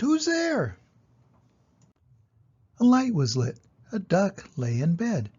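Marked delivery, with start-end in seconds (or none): 0.0-0.8 high, startled cry of who's there; frightened shriek. Acted. Mouse in the dark wolf belly, shrieking up high. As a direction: jump in fright and call out high. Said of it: "The mouse shrieked".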